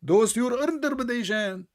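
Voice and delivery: high-pitched, insistent outburst; heated protest. Real, not acted. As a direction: push back hard, repeating the denial. Heated denial in religious debate.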